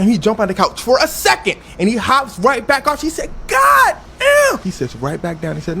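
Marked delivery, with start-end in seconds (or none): 3.5-4.6 high, loud startled exclamation; mock shocked shout. Oprah reacts to Tom on the couch, shocked shout. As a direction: yell it in exaggerated shock.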